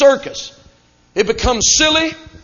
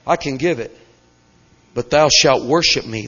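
low, sly devil voice, much lower pitch; Satan's offer; 11 semitones lower than usual. Acted. Satan tempting Jesus in the wilderness, low sly voice.

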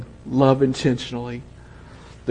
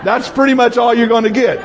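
words spilling out through real laughter; laughing; 10 semitones higher than usual. Real, not acted. Preacher tickled by the grandkids' story, laughing. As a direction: laugh while you finish the line. Laughing at kids' Sunday school answers.

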